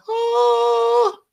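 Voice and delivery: very high held angelic tone; beautiful sound. Retold or imitated. Imagined sound of a shining beard, very high. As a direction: sing out one sweet high note. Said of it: "beautiful sounds like".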